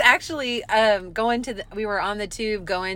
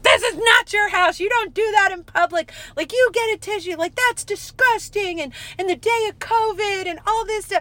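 acted-out outraged telling-off, pitched up; retold screaming row; 10 semitones higher than usual. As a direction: scold a stranger in an imitated outburst. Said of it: "screaming back and forth".

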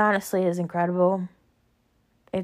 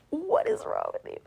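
mock disbelief, much higher; incredulous; 12 semitones higher, 3 dB quieter than usual. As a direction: pitch it up in shock at mom. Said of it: "I was like".

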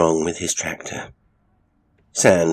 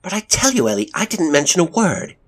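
high, loud, urgent protest; raised, emphatic denial; crying out; 12 semitones higher, 5 dB louder than usual. A narrator's reading. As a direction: cry out in your own defence. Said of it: "cried Elizabeth".

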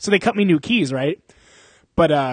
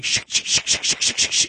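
imitated brake scrape. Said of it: "you hear that, like".

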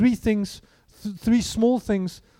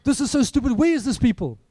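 loud, high griping about the wait; the fed-up man in the queue griping loudly about the wait; mock outraged complaint; 5 semitones higher, 5 dB louder than usual. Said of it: "it's like".

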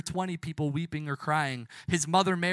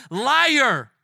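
loud, high crowd taunts; yelling jeers; 6 semitones higher, 6 dB louder than usual. Acted. Crowd yelling at Jesus from the hill's bottom, jeering. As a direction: yell it up the hill in mockery. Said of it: "would be yelling".

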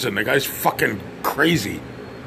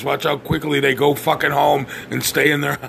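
ordinary talk

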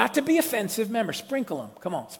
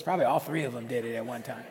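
ordinary talk

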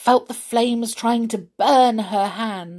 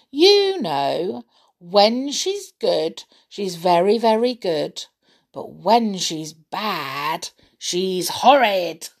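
slow, leaning on good and horrid; knowing emphasis; 2 semitones lower, slower than usual. Acted. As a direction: stretch out the contrast. Bear's sly verdict on Amelia.

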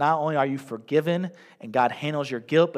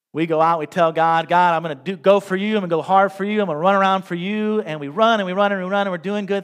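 preaching; 5 semitones higher, 8 dB louder than usual. Acted. Pastor builds energy again.

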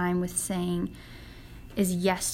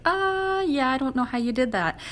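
retold astonishment, pitched up; shocked reaction; 4 semitones higher than usual. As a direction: react baffled, acting it out. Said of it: "I was quite shocked".

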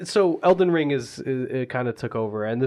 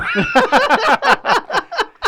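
loud, high-pitched real laugh; big laugh; 8 dB louder than usual. Real, not acted. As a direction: burst out laughing at your own joke. Laughs at own joke.

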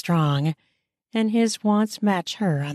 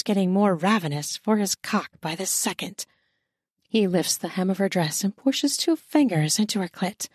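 ordinary delivery

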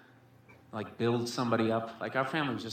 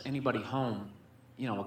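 ordinary delivery

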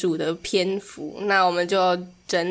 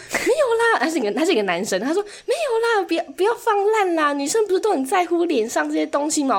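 a much higher, startled voice for the salesman; surprised salesman; 9 semitones higher than usual. Retold or imitated. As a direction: mimic a stunned guy insisting, pitched up. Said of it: "他就整个超惊讶".

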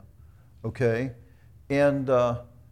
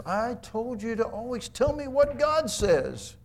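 irritated king scolding; 9 semitones higher than usual. Retold or imitated. Ahab scolds Micaiah.

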